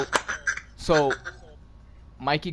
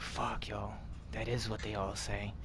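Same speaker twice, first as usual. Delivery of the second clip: much softer, low resigned mumble; defeated mutter; 5 semitones lower, 15 dB quieter, slower than usual. Retold or imitated. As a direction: give up under your breath. Resigned, caught by the cop.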